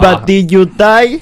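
high, slow mock Mr. Chow voice; slow squeaky movie quote; Mr. Chow impression. Quoting famous Mr. Chow line.

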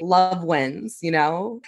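usual speaking voice

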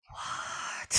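breathy, voiceless disbelief; stunned whisper. Retold or imitated. Isis learning of the affair, outraged whisper. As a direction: hiss it out in a furious whisper. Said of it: "really mad".

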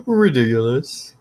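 slow, drawn-out disbelieving repeat; double take. Real, not acted. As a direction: stretch it out in disbelief.

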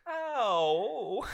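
high, drawn-out mock "ow"; mock pained howl. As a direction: imitate a punk howling from a burn. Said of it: "falls on a cigarette and goes".

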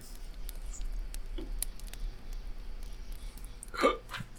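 sharp hiccuping gasp. Real, not acted. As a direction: catch a hiccup mid-breath. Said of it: "I had the hiccups for a second".

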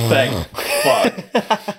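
laughing relief. Retold or imitated.

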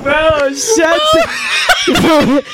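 high laughing shout of disbelief; laughing yell. Retold or imitated. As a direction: yell at him while cracking up. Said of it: "we're like".

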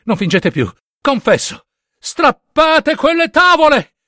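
high, loud, slow frenzied shouting; frantic screamed confession. Acted. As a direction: scream it out in mad desperation. Confessione urlata agli ufficiali. (confessione urlata, frenetica e acuta)

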